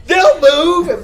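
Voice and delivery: high, loud yell imitating the lady; acted-out hollering; retold scream. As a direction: shout it back dismissively. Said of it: "the lady's still screaming".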